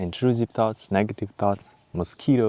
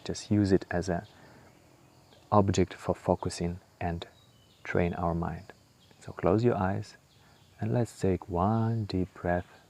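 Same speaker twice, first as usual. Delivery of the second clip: guided meditation; 5 dB quieter than usual. Acted. Guiding the listener through breath and body scan, calm meditation voice. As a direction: ease the listener inward, unhurried.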